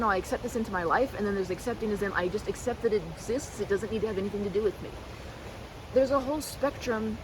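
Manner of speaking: ordinary talk, nothing marked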